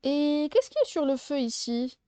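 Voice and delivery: ordinary talk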